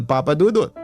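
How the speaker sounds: usual speaking voice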